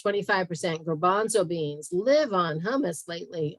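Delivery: ordinary talk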